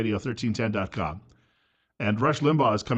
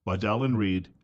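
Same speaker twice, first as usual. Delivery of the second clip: ordinary delivery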